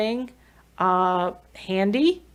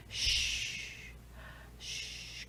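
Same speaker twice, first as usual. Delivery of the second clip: long unvoiced shh, fading softer; soft shushing; 18 dB quieter than usual. Shown as a demonstration. Parent soothing a baby with fading shushes. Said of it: "even if you have a typically developing baby".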